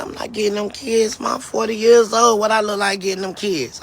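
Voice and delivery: retold protest. Daughter refusing her mother about the kids, protesting.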